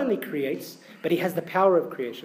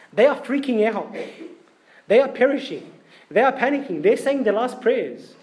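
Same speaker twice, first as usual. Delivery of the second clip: higher and louder, urgent; rising panic; 5 semitones higher, 3 dB louder than usual. Acted. Preacher paints the panicking disciples, higher and louder. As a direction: build the disciples' fear.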